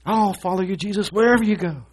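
high, loud mock-eager promise; eager vow. Preacher mocking a new believer's eager vow, high and loud. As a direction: belt it out like a starry-eyed convert.

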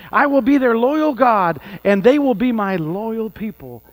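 loud, ringing reading of the Bible; fervent scripture reading. Acted. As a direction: proclaim the verses with fire. Reads scripture with force.

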